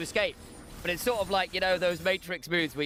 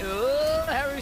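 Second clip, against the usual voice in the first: surprised; 9 semitones higher, 4 dB louder, much slower than usual. Real, not acted. Reacting to a teamfight.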